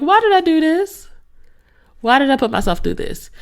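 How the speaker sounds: high, mock-wailing self-questioning; tearful regret, retold. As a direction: whine in regret, hammed-up. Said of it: "I'm, like, crying and tears".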